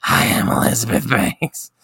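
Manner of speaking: whispered, voiceless mock sick voice; imitating a croaky, sick presenter; sick Elizabeth Banks. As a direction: rasp it out like you've lost your voice. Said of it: "she was super sick doing it".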